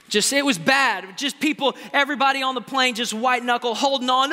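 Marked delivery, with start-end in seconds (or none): none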